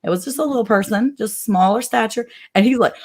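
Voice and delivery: usual speaking voice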